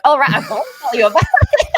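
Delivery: high, loud reply breaking into laughter; retold exclamation. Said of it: "I was like".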